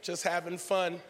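ordinary delivery

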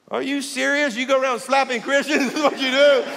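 high, loud disbelief with a laugh; shocked outrage at being slapped; incredulous. Retold or imitated. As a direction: act stunned he'd slap you. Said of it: "I'm like".

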